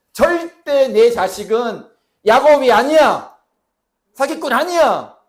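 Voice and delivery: loud, slow, emphatic declaration high up; firm shout. Pretend. God declaring to Jacob, a firm slow shout. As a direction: proclaim it like God refusing the old name. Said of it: "단호하게 말씀하셨다고요".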